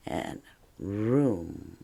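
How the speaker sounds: quiet and room set apart from bath; soft separate room after a pause; syllable split. Shown as a demonstration. Teacher marking the syllable break softly. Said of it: "put in your little dot between bath".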